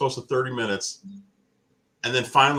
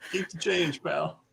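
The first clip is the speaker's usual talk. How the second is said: mock sarcastic; 7 semitones higher, 4 dB quieter, slower than usual. Joking tip to worker.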